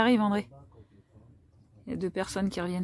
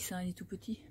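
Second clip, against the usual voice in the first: whisper; 14 dB quieter than usual. Real, not acted. Close to the crab, hushed.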